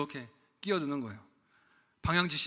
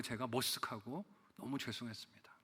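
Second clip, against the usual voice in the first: whispered, sheepish; embarrassed whisper; 6 semitones lower, 12 dB quieter than usual. Real, not acted. Pastor admits his embarrassment to the congregation, whispered. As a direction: breathe it out ashamed.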